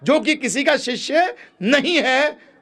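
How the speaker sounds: high-pitched, forceful sermon delivery; emphatic preaching. Real, not acted. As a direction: drive the teaching home, voice pitched high. (ऊँचे सुर में ज़ोरदार प्रवचन)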